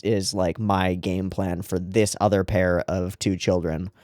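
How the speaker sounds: ordinary talk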